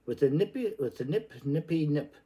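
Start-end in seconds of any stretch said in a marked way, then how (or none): none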